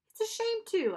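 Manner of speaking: high, put-on regretful sigh. A narrator's reading. Boy tempts Charon with coins, sighing regret. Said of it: "I sighed".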